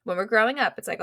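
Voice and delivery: ordinary delivery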